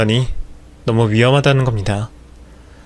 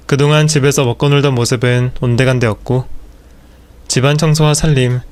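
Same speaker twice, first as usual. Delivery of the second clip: ordinary delivery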